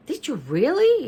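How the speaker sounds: high-pitched doubtful question; doubting. A narrator's reading. Leo challenging the girls' claim, doubting.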